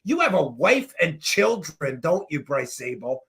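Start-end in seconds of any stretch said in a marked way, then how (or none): none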